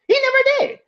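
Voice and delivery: mock-shrill emphatic squeak; high squeak. Real, not acted. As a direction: spike the voice way up on never. Insisting, voice jumps way up.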